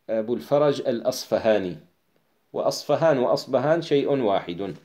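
usual speaking voice

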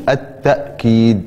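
التأكيد with a glottal stop, at-ta'-keed; with a hamza. Shown as a demonstration. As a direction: cut the short "a" of التأكيد with a hamza before the k. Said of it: "وبالهمزة تقول".

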